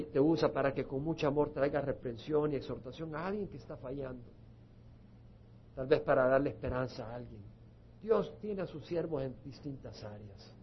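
ordinary talk

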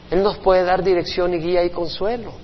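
normal talking voice

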